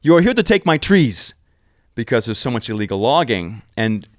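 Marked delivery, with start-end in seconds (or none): 0.0-1.3 higher, louder, put-on voice of a suspicious governor; hostile governor. Governor demanding why the tourists came, imitated in a high, loud put-on voice. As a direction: confront the intruders in your jungle. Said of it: "And he goes".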